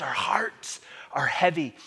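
usual speaking voice

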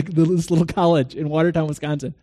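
usual speaking voice

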